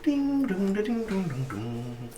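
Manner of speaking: high sung ding-dong chime, fading away; sung door chimes. Retold or imitated. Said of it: "you hear these magical chimes go".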